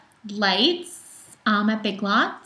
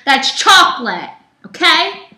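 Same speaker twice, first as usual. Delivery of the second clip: loud emphatic warning; 5 semitones higher, 7 dB louder than usual. Acted. Loud follow-up after whisper.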